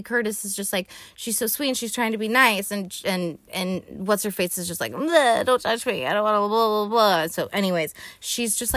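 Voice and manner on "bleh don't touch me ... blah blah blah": standoffish. Retold or imitated.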